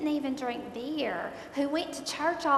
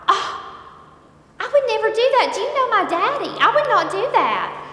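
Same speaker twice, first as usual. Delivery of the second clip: much higher, louder offended pleading; appalled protest; 9 semitones higher, 10 dB louder than usual. Retold or imitated. Wife insisting to rehab staff she would never smuggle, much higher and louder. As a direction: play the offended good daughter.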